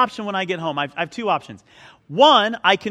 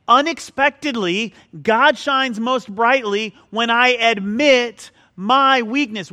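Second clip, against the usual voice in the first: slow, louder and higher proclamation; proclaiming; 4 semitones higher, 5 dB louder, slower than usual. Acted. Pastor proclaims his key point to the church, slow and loud. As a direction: repeat it and lift it so the whole room hears.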